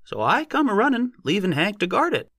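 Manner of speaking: high, excited rustic squeal. A narrator's reading. Odd-job man telling how he came running, squealing. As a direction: keep the tale going in a high squeal. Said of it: "His voice broke to a sort of squeal".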